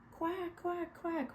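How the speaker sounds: high little quack quack quack; high-pitched duckling quacks. A narrator's reading. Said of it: "went Jack, Cack, Lack".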